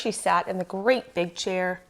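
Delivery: ordinary delivery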